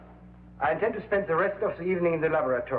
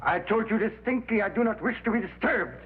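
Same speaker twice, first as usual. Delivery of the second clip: raised, angry shout; 7 semitones higher than usual. Acted. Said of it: "I will not have you shouting at me".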